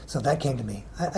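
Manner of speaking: normal talking voice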